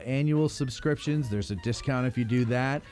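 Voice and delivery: ordinary talk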